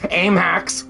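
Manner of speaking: high, mock-indignant bird voice; high cartoonish accusation; screeching bird. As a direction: squawk it as the beaten bird's complaint. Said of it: "It was more like".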